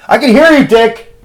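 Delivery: playful angry yell; shout. Real, not acted. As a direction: yell so the guy behind the door hears. Shouting at an eavesdropping Luke.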